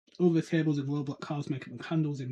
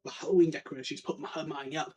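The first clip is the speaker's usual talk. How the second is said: ordinary talk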